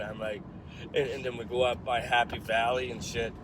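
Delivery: ordinary talk, nothing marked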